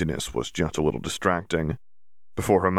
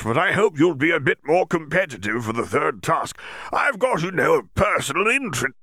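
Bagman, hearty; 9 semitones higher than usual. Acted. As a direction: lay on the enthusiasm like a jolly sports official. Bagman voiced.

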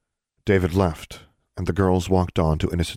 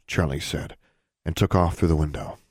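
ordinary delivery

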